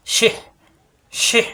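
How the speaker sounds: শিহ closed with a breathy h squeezed in the throat; throat-pressed h. Shown as a demonstration. Teacher drilling a vowelless letter, throat-pressed end on শিহ. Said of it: "কণ্ঠ নিতে চাপ".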